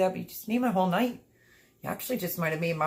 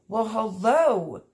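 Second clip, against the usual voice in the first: drawn-out hello; 3 semitones higher, 5 dB louder, much slower than usual. Real, not acted. Greeting a visitor warmly.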